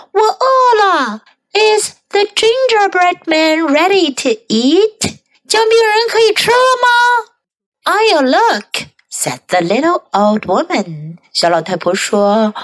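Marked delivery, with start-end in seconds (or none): 0.1-7.3 put-on high-pitched hungry old man; old man character. A narrator's reading. Hungry old man asks about the gingerbread, high voice. Old man's line in story. 7.8-8.9 lightly voiced old woman; old woman character. A narrator's reading. Old woman answers her hungry husband, lightly voiced, higher.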